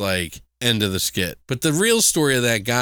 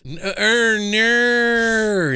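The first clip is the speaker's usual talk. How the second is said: high, drawn-out Australian "oh, no"; Australian accent; 9 semitones higher, 4 dB louder, much slower than usual. Shown as a demonstration. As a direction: show her how the Australian accent goes. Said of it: "It's fucking Australian".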